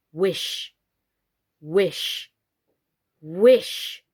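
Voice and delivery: a hint of hardening command; firm Totty. A narrator's reading. Totty urging the dolls, a touch firmer and harder. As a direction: say each wish firmer. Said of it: "her seemed to harden".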